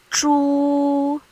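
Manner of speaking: Zhu held high and level; first tone. Shown as a demonstration. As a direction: say Zhu on one steady high note. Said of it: "this is how we mark the tones".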